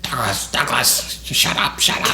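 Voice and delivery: hushed, voiceless mimic of mom; frantic drunk mother. Drunk mother hushing her kid, frantic hushed imitation. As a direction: imitate a mother urgently hissing for silence.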